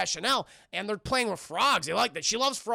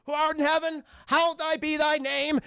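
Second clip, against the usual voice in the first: high, loud, chanted mock prayer; fervent praying; 8 semitones higher, 5 dB louder than usual. Missus Jones prays in her garden, high fervent chant. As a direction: pray in a strained crazy-lady wail. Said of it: "he just sees her praying".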